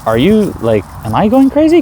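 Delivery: sudden high-pitched disbelief; incredulous. Retold or imitated. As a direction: squeak out the question in disbelief. Retelling his own disbelief.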